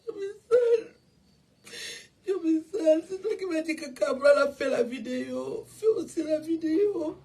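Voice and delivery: high-pitched sobbing words; words wrenched out through real tears; crying out. Real, not acted. A mother mourning Cabrel breaks down, sobbing the words high.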